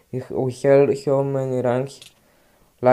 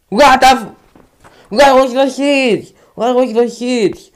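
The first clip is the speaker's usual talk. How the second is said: loud, higher outrage; outraged yell; 8 semitones higher, 5 dB louder than usual. Real, not acted. Gamer yelling after being killed. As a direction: yell it in disbelief.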